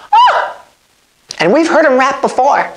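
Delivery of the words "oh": very high sudden yelp; shout. Real, not acted.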